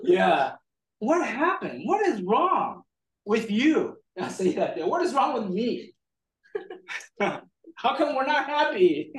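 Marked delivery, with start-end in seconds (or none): 1.0-3.9 high-pitched, swooping exasperation; mock exasperated questioning; exasperated parent. Retelling exasperated parent to child. 4.8-9.1 high-pitched, plaintive questioning; stretched-out, exaggerated bafflement; mock exasperation. Retelling exasperated self-questioning.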